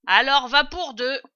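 high, loud voice; loud decision. A narrator's reading. Woman in the cellar settling on two jars, loudly.

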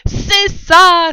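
high, loud cry of discovery; triumphant exclamation. A narrator's reading. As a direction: call it out excitedly. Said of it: "s'écria Maître Renard". (exclamation forte et aiguë)

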